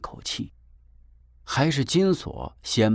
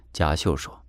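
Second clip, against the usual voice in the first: ordinary delivery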